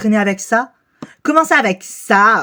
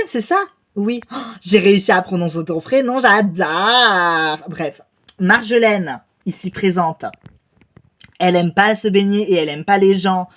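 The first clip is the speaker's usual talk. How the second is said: ordinary delivery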